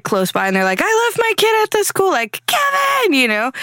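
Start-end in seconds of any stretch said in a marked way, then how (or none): 0.8-2.1 mock panicked parent. Parent voiced in story. 2.5-3.1 high, yelled Kevin; calling out. Retold or imitated. Home Alone parent calling.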